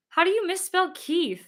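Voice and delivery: high, incredulous question; amused disbelief. Real, not acted. Amused disbelief about misspelling.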